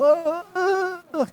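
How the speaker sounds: high siren wail; imitating a siren. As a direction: wail like a fire truck siren. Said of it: "que nem fazendo igual o bombeiro". (imitação de sirene de bombeiro, aguda)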